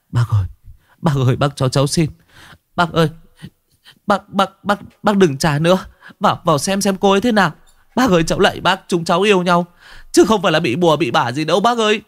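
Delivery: high, fast, frantic pleading; young teacher pleading with the shaman, high and rushed; desperate begging. Acted. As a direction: beg for her life, words tumbling. Narrator begs shaman desperately.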